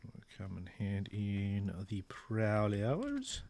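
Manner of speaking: ordinary talk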